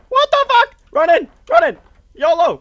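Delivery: high, loud, urgent yelling; panicked shouting. Real, not acted. Player rushing into danger, high panicked shouts. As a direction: yell it in bursts as you charge in.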